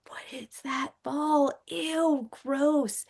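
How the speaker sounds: high-pitched, drawn-out disgusted reaction; grossed out. Retold or imitated. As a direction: recoil in disgust, voice jumping up high.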